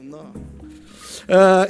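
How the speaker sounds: usual speaking voice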